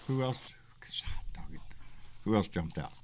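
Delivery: normal talking voice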